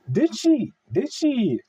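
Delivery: high, loud startled question; sudden realization. Real, not acted. Suddenly remembering the cancellation, high and loud surprise. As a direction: it just dawned on you. Sudden recollection about [name].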